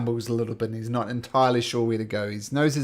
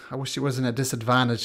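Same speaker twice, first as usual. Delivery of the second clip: usual speaking voice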